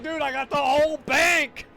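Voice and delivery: imitating a proud buddy showing off; bragging friend. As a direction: brag it like you pulled off a heist. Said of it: "He's like".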